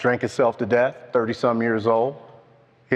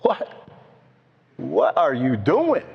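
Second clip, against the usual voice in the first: mild mock yell, slowly stretched; exasperated demand, drawn out without real shouting; retold scream at God; 3 semitones higher, slower than usual. Driver demanding answers from God, as a mild retold scream. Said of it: "screaming at God again".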